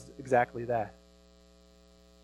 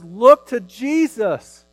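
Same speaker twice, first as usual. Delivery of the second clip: much higher and louder, slow urgent call; voice of God pleading; 7 semitones higher, 7 dB louder, slower than usual. Retold or imitated. God calling out to sinful humanity, slow high urgent plea. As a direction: plead with sinners to turn to Jesus. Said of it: "And he says".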